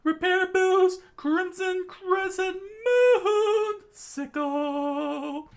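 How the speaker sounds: very high, loud, drawn-out yell; screamed attack names. Acted. Andy screaming his attack at Unrepaired, very high yell. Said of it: "he screams out".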